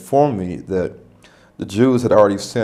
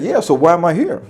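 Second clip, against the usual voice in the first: exasperated question; 9 semitones higher, 4 dB louder than usual. Retold or imitated. Pressing the manager for answers.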